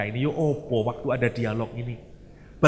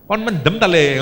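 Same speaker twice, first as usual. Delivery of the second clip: put-on loud teasing "are you drunk, kid"; hammed-up jeering friend; mock friend; 3 semitones higher, 6 dB louder than usual. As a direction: burst out laughing at a buddy. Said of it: "friend's mocking reaction".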